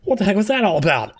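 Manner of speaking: high, quick indignant protest; exasperated Maya. Acted. Maya venting to Wright about giving up, exasperated. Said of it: "Why are you yelling at me, Maya?".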